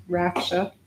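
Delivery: "raksha" with a flat a as in rack; mispronunciation shown. Shown as a demonstration. Teacher showing what it's not, "raksha" as rack-sha. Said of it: "It's not raksha".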